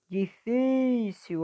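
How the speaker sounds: "Difícil" slow, FÍ held high; teacher sustaining FÍ in "Difícil"; stretched stressed syllable. Shown as a demonstration. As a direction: pull out the "fí" in "Difícil". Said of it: "puxando mais a sílaba forte".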